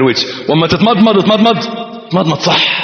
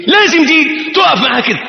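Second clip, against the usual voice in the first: emphatic shout; 9 semitones higher than usual. Real, not acted. Preacher stresses the point.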